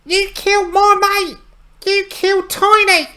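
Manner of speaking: very high, loud squeaky imp shriek; furious imp. Acted. Imp screeching at Harmug over its dead friend Tiny, high and furious. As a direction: shriek the accusation like an enraged little goblin.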